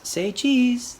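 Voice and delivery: loud, high photo call; yelled. A narrator's reading. Friends yelling for the group photo, loud. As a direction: call it out for the camera. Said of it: "they yelled".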